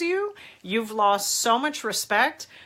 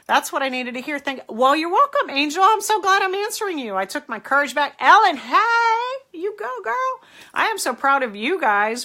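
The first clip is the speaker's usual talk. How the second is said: much higher and louder, gushing; squealing praise for her live viewers; excited cheering; 7 semitones higher, 7 dB louder than usual. Real, not acted. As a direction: cheer the viewers on with a burst of delight. Thrilled reading viewers' comments.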